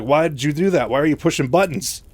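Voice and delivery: mock indignant questioning; acted reproach. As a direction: act out scolding someone for meddling. Acted reproach from Raynor in story.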